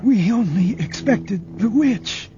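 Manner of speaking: high, slow, fading voice of a dying man; dying agent. A narrator's reading. Dying agent answering Luke, high, slow and faint. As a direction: let each phrase cost him breath.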